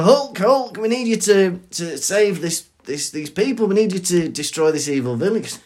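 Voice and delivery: high, hammed-up appeal for help; urgent plea. Villagers begging Hulk to save them, urgently. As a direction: call out in mock panic. Acting people pleading with Hulk.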